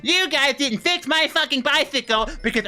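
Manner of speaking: high-pitched, mocking whiny complaint; mock the customer in a high whine; whiny customer imitation. Bike mechanic imitating a customer demanding a refund, high mocking whine. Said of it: "been like".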